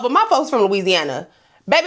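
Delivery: usual speaking voice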